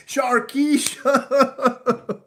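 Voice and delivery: name stretched very slowly through laughter; helpless laughing drawl. Real, not acted. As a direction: drag the name out, cracking up.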